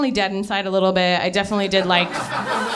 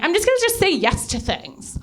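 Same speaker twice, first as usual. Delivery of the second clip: high, mocking naive girl; mock your younger self in a high voice; self-mocking airhead pitch; idiot girl; 8 semitones higher than usual. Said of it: "like a fucking idiot girl says".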